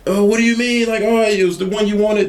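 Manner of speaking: high startled protest; flustered. Retold or imitated. Salesman answering the angry customer, high and flustered. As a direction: pitch it up, caught off guard. Confused reply to angry caller.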